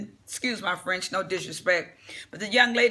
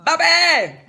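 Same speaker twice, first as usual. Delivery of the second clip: loud call; 10 semitones higher, 10 dB louder than usual. Real, not acted. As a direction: shout the name high and loud. Suddenly calls out the name.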